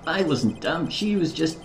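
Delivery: high, loud Yorkshire lad; Yorkshire accent. Acted. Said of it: "I'll do a Yorkshire accent".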